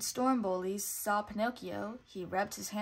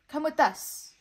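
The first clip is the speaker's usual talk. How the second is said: sly; 6 semitones higher, 7 dB louder than usual. A narrator's reading. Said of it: "said the fox slyly".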